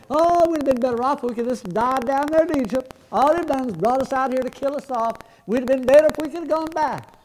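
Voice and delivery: high-pitched imitation of grumbling people; lightly acted bellyaching; mock moaning. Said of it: "they're moaning and crying".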